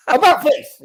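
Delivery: loud high barked order; captain barking about face; drill command. Retold or imitated. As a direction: bark it like a drill officer.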